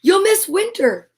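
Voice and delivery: small voice calling out, high and urgent; mouse crying out. A narrator's reading. Mouse crying out to Bear, high and pleading. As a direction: pitch it up and call to him. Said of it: "Mouse cried".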